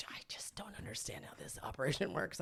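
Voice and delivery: a hushed, bewildered whisper. Retold or imitated. Wife confessing she doesn't get the books, whispered. Confused wife quoted at budget time.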